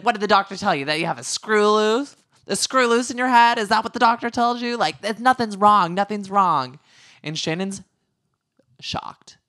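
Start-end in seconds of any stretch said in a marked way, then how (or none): 0.0-6.8 high, loud imitation of a tirade; mock berating. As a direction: harangue her in a heightened mimicking voice. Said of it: "And then she's like".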